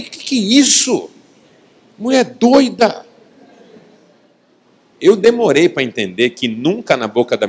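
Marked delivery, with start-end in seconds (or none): 0.0-3.1 high, incredulous mock outcry; acted exasperated retort; indignant husband. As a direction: exclaim it, drawing out the last words.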